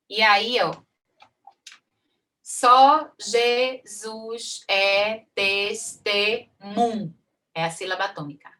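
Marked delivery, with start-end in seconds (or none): 2.4-7.2 very slow, each syllable pulled apart; syllable count. Shown as a demonstration. Teacher counts syllables aloud for students, drawn out slowly. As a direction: stretch "só Jesus é testemunho" out one poetic syllable at a time. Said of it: "Temos sete espaços aqui".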